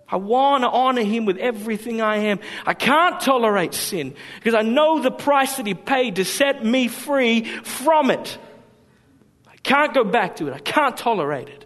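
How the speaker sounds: slowing, ringing pulpit declaration; fervent preaching. Real, not acted. Preacher declares devotion to the congregation, fervent preaching. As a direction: testify with rising conviction.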